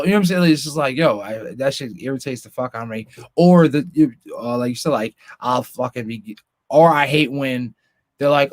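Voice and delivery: ordinary delivery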